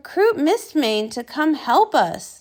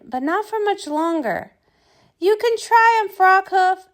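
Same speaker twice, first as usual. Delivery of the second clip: ordinary delivery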